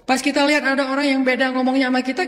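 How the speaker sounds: ordinary talk, nothing marked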